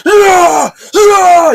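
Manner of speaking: two high, full-force roars; imitated battle scream, very loud and high; seething scream. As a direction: scream "Ah!" in rage at an opponent. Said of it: "screams at the".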